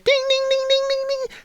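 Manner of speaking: high, ringing "ding" repeated on one note; imitated bell. Shown as a demonstration. Host announcing the main event, mock bell. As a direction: sing out like a ringside bell.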